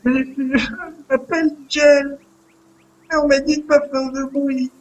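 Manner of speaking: put-on high, faltering child's voice; timid caged child. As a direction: answer hesitantly as a frightened little captive. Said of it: "Vous entendez une voix". (petite voix aiguë d'enfant apeuré)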